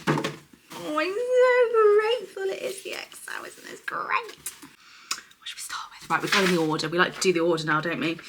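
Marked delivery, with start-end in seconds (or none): 0.1-6.1 excited squeal. Real, not acted. Excited squeal opening the box.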